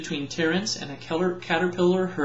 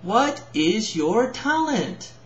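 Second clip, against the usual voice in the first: slow, deliberate, higher and louder question; spoke louder; 6 semitones higher, slower than usual. Acted. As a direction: spell it out slowly to someone not hearing. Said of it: "She spoke louder".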